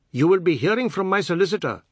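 high, loud legal threat; indignant threat. A narrator's reading. Biswas at the banister threatening Seth, indignant. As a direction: declare it loudly, full of indignation.

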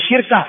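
شركة as shirka, kasra moved onto the shin; shifted vowel. Shown as a demonstration. Teacher showing the vowel shift, شركة said shirka. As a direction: say شركة as shir-ka, no vowel after ra. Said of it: "بنقل حركة الوسط إلى الفاء".

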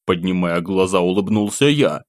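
usual speaking voice

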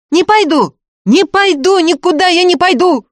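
very high, loud cries; hysterical, defiant yelling; screamed refusal. A narrator's reading. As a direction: shriek it stubbornly, almost with hatred. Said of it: "кричала Устинья".

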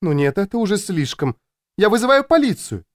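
indignant, pitch climbing high; outraged wife. A narrator's reading. Wife on the phone threatens police, outraged.

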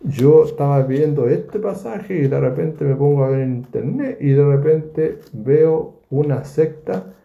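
ordinary talk

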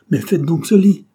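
loud impatient command; impatient order. A narrator's reading. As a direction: order it with exasperation. Armand repeats a disobeyed order.

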